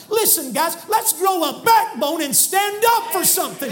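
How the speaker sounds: rally them to stand up; preaching shout. Real, not acted. Preacher shouting to the congregation to grow a backbone.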